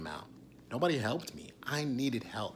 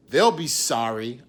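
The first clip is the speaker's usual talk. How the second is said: spiteful vow; 11 dB louder than usual. Retold or imitated. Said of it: "I thought that shit".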